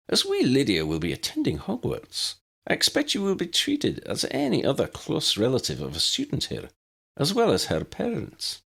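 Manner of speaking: high, prim Scottish headmistress voice; brisk, proper elderly professor; McGonagall. A narrator's reading.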